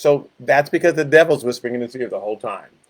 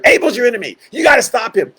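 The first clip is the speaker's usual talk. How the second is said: a touch louder, higher pushy egging on; devil urging; 7 semitones higher, 5 dB louder, faster than usual. Acted. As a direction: goad Cain toward his brother.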